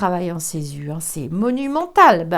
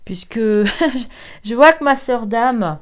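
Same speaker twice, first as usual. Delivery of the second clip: usual speaking voice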